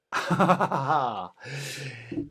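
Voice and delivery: short trailing chuckle; laugh. Real, not acted. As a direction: laugh it off after the chant. Laughs after finishing the chant.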